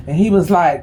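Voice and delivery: ordinary delivery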